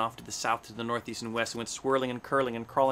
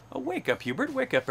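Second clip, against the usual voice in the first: high, swooping wake-up cry; urgent rousing call; 12 semitones higher, 3 dB louder than usual. A narrator's reading. Hornbill rouses sleeping Hubert, calling out high and urgently.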